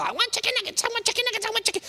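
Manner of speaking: put-on very high childish whine; high-pitched kid demanding chicken nuggets; whiny child. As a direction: chant it like a kid begging. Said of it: "Your kids go".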